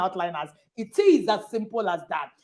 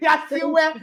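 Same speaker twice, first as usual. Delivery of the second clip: laughing; 7 semitones higher, 3 dB louder, slower than usual. Real, not acted. Laughs while praising the pastor.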